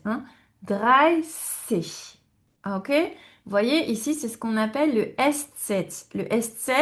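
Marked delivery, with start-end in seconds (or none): 0.6-2.2 "Dreißig" drawn out with a hissed s; s, not tz. Shown as a demonstration. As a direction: split "Dreißig" on a long s, no t. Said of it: "Attention, on n'a pas le tz". (« Dreißig » étiré, avec un s sans t)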